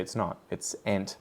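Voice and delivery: normal talking voice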